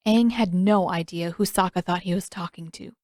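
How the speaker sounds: plain reading voice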